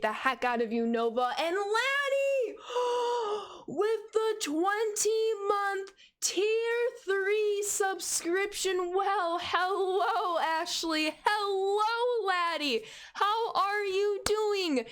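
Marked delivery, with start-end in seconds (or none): 1.4-2.6 high, slow, drawn-out "Laddie"; light up at the name; excited shout-out. Real, not acted. Thrilled to see Laddie's subscription renewal. Long-time sub renewal. 2.6-3.6 delighted gasp. Real, not acted. Gasping at Laddie's twenty-month sub. 3.7-14.8 high, slow, sing-song hello; excited welcome. Real, not acted. Greeting a loyal subscriber with delight.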